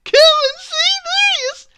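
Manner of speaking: high, loud shout to a crowd. A narrator's reading. Miss Trouble calling everybody over at the top of her voice. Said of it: "she shouted at the top of her voice".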